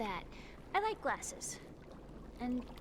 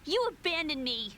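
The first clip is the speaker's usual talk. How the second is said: louder, higher, bitter accusation; angry outburst; 7 semitones higher, 5 dB louder than usual. Acted. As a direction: lash out at the brother who left. Sister accuses brother angrily.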